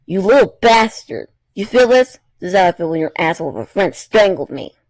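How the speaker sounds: loud, high enraged yelling, then fast; angry strangler shouting; furious girl. Acted. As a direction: spit it at him in rage. Miu attacks Kokichi.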